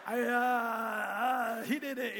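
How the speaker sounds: drawn-out, flustered stalling; mortified stammer. Retold or imitated. Caught heckler after referee pointed at him, mortified stammer. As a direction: stammer like you've been caught, then pass the blame. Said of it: "turned like Fifty Shades of Red".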